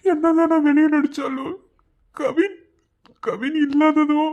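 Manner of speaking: tearful breakdown. Acted. Grieving friend tells her of his fear, crying.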